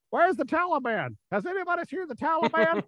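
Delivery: high mocking voice of a clueless soldier. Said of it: "if you're the guy going".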